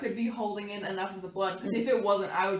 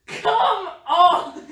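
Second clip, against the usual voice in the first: high, laughing outburst; loud, high exclamation through laughter; loud protest; 11 semitones higher, 13 dB louder than usual. Real, not acted. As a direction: cry out in amused disbelief. Player protesting the game master's reveal.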